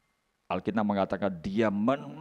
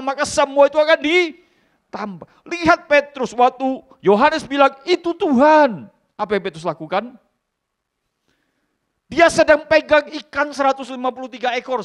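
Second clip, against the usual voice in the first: preaching at full force; 12 semitones higher, 8 dB louder than usual. Acted. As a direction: stir the congregation. Preaching high and loud. (khotbah keras dan bersemangat)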